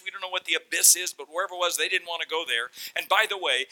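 ordinary delivery

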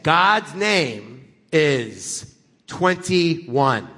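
very slow, weighty statement; slow solemn. Retold or imitated. Serious stranger announces a belief, very slow. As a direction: drop it like a revelation.

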